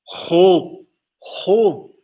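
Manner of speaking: imitating a coworker's thick Hull accent. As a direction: say the town name in thick Hull dialect. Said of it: "that's a really thick accent".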